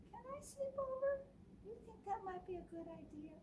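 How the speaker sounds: high, fast pleading question; high moose voice. Acted. Moose asking Bruce to sleep over, high. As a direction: beg sweetly for a sleepover. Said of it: "And Moose says".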